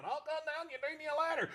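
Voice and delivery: high deadpan horse puppet. Acted. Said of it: "his voice is really close to my normal voice".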